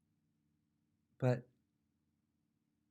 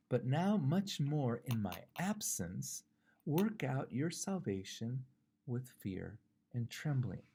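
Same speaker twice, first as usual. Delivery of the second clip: slow, deliberate Bible verse; scripture reading; 3 semitones higher, 4 dB louder, slower than usual. Acted. Preacher reads Philippians to the congregation, slow scripture reading. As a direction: proclaim the verse with drawn-out emphasis.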